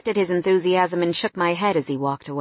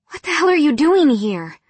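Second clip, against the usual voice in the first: shocked outburst; 8 semitones higher, 5 dB louder than usual. A narrator's reading. Shocked at his arrival.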